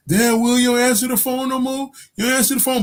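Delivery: high-pitched complaining caller; mock complaint. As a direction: imitate a put-out caller in a high voice.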